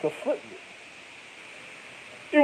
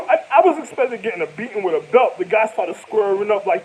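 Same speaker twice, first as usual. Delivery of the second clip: ordinary talk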